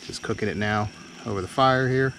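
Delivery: ordinary delivery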